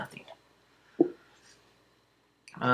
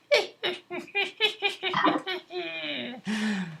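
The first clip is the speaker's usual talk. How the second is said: high, eerie ghostly giggle trailing away; long high-pitched spooky laugh; whispery echoey laugh. Acted. Unseen ghost behind the party, whispery mocking laugh. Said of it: "you guys hear this whispery, echoey laugh".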